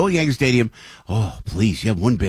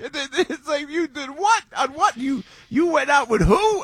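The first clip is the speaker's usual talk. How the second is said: high, disbelieving questions; mock astonishment, way up high; incredulous. Acting incredulous passenger.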